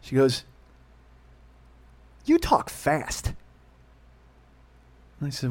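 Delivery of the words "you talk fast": very slow, higher, drawn-out words; imitated mother's complaint. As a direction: stretch each word in mock accusation.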